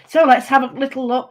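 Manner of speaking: normal talking voice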